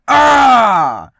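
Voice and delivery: high, sustained scream falling at the end; sudden loud yell. Acted. DIY host doing the only step, huge yell. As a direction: let out a full-force comic yell.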